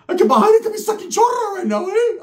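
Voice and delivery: way up high and loud, cartoonish; sudden squeaky comic voice. High loud pitched voice mid story.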